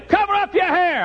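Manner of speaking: very high, loud mama voice; hollering mother. Retold or imitated. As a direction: holler orders at your son like a fussing mother. Mama would say.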